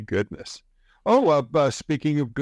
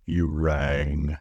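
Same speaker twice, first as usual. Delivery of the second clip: low, slow Lurch voice; Lurch impression; 9 semitones lower than usual. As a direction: drop low and deadpan like a butler. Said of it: "get Lurch to answer".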